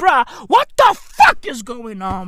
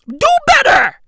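shout; 9 dB louder than usual. Real, not acted. Ranting, calls out Sierra Leone.

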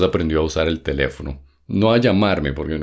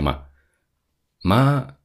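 higher, drawn-out mock call; calling for mom; 6 semitones higher than usual. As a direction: call out to mom, voice raised in pitch. Demonstrates how he called his mother.

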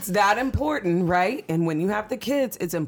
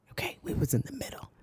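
hushed whisper, much softer; 6 semitones lower, 10 dB quieter, faster than usual. Real, not acted. Telling a bedroom story to a friend, whispered. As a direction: whisper it like a secret. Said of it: "The fact that we're even whispering".

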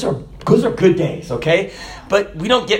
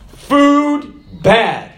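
grumpy monk complaint; 7 semitones higher, 3 dB louder than usual. Retold or imitated. Silent monk's grumpy second complaint.

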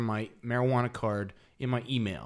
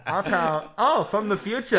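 radio announcer; 7 semitones higher, 4 dB louder than usual. Retold or imitated. Recreating radio announcer bit.

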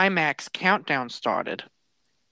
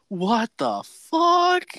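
slow retold disbelief; 4 semitones higher, much slower than usual. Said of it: "And he went".

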